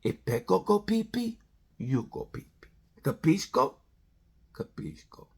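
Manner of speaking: a hint of a hushed mob heavy; mob enforcer. Riders guarding Pecco, mock mobster quietly. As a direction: quietly lay down the rules like a wise guy. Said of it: "he's like the mob boss".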